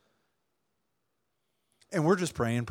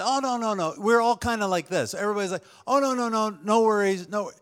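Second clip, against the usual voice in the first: polite protest; 7 semitones higher than usual. Retold or imitated. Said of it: "we all say".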